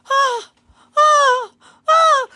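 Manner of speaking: frightened high-pitched gasps; panicked squeals. Real, not acted. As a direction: squeal in wordless terror. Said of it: "I can't form any words, I'm just making noises".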